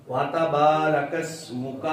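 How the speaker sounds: slow وَتَبَارَكَ اسْمُكَ with a distinct "wa-ta" and long با; separated syllables. Shown as a demonstration. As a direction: recite وَتَبَارَكَ اسْمُكَ slowly, وا and تا apart. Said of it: "وا تا، وا اور تا". (وَتَبَارَكَ اسْمُكَ، ٹھہر کر، واو اور تا الگ)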